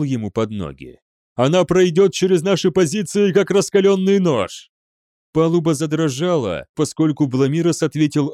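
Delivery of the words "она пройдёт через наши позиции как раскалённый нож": high, angry shout; heated warning. A narrator's reading. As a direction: argue forcefully in alarm. Перепалка командиров в бою. (горячо кричать предупреждение)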